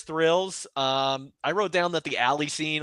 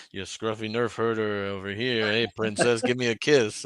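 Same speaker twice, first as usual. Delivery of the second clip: Han Solo; 3 semitones lower than usual. Retold or imitated. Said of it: "'Cause he's always Han Solo".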